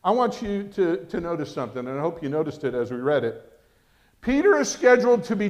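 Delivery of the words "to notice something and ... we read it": ordinary talk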